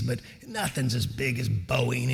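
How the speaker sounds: slow, quiet, deliberate judge; judge's voice. Retold or imitated. Judge telling a candidate about Boeing, slow and soft. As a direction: draw it out softly like the judge. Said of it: "that's exactly how he talks".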